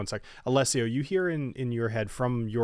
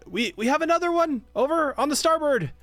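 high yell across the ship; 14 semitones higher, 4 dB louder than usual. Acted. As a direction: call it out from across the deck. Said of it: "he yells".

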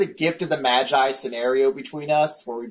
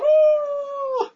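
mock yelp; 4 dB louder than usual. Imitating screaming while chewing.

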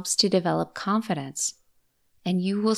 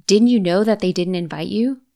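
taunting voice; 2 semitones higher, 8 dB louder than usual. Shown as a demonstration. Voicing a nagging critical thought.

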